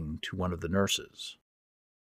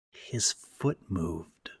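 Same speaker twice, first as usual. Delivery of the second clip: slow, softer hiss from the corner of the mouth; hissed aside; 7 dB quieter, much slower than usual. Acted. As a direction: hiss it without moving your head. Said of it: "I managed to hiss out of the corner".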